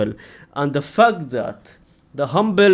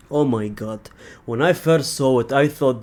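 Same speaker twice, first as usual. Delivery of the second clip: ordinary talk, nothing marked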